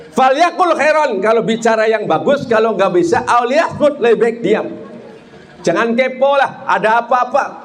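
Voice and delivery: high-pitched, sustained preacher's delivery; impassioned preaching. Acted. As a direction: preach it high to the whole congregation. (ceramah berapi-api dengan nada tinggi)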